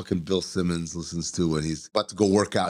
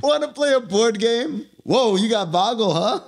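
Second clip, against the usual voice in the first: eager buddy voice; 12 semitones higher than usual. Acted. Voicing Gary inviting a board game.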